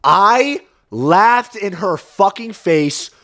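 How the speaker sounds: "I laughed in her fucking face" high, loud, every word stretched; emphatic outrage. Real, not acted. Outraged punchline, drawn out.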